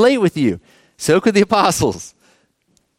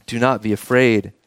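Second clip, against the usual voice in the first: lower, soft reassuring voice; gentle comfort; 7 semitones lower, 3 dB quieter than usual. Acted. Jesus to the scared disciples, gentle and reassuring. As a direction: say it like calming frightened men.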